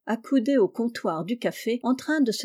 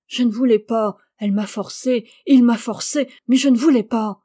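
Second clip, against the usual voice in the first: louder, desperate pleading; distraught outburst; 7 dB louder than usual. Acted. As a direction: cry it out in anguish. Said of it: "comme se parlant à lui-même".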